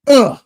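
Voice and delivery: short, high, loud "ugh"; loud groan of disapproval. Real, not acted. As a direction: blurt out a sharp high ugh. Reacting to the quoted budget talk.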